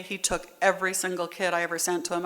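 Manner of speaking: ordinary delivery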